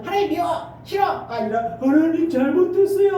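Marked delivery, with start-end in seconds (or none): 0.0-1.3 high-pitched petulant outburst; acted childish refusal; mock sulky child. Acts childish rejection of God. 1.8-3.1 loud, high wailing apology; hammed-up repentant plea; mock tearful child. Acts repentance like crying daughter.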